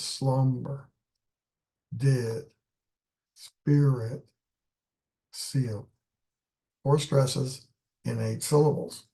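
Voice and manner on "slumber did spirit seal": slumber, did, spirit, seal spoken one by one, each stressed and spaced; stressed words listed. Shown as a demonstration. Teacher counting the four stresses for the class, word by word.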